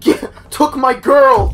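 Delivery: high, loud alarmed cry; panicking gamer. Real, not acted. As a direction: yell in sudden alarm. Panicking at Slender Man.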